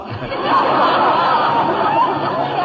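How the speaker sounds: big high gloating laugh; gleeful cackling laugh. Acted. Whiteside gloats over the beaten Stanley with a high laugh. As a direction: crow with triumph in a laugh.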